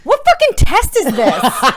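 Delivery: slow incredulous laugh through it; amused outrage at a silly test; laughing disbelief. Real, not acted.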